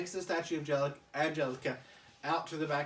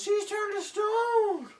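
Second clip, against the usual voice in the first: much higher, louder and slow; a wide-eyed toddler announcing doom, drawn out; Phil the baby; 7 dB louder, slower than usual. Retold or imitated. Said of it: "And Phil goes".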